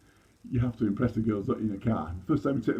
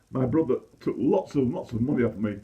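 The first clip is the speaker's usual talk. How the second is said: ordinary delivery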